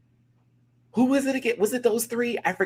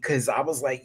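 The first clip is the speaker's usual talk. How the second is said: normal talking voice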